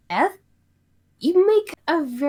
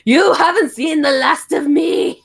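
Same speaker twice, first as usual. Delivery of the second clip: lightly hammed villain threat; a touch of cartoon villain vowing revenge; mustache twirling villain; 5 semitones higher, 6 dB louder than usual. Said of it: "cliche quotes like".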